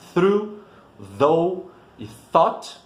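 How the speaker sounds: "through", "though" e "thought" ditos devagar e destacados; contraste de pronúncia. Shown as a demonstration. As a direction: pronuncie as três palavras com cuidado. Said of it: "o som muito diferente". ("through", "though", "thought" em inglês, bem lentos)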